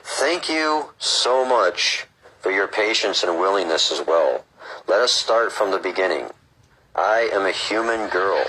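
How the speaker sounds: slow, measured read-aloud; book reading. Acted. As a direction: read it out deliberately, phrase by phrase.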